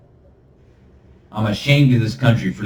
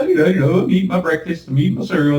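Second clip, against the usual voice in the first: oblivious; 8 semitones higher, faster than usual. Pretend. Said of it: "we're just like".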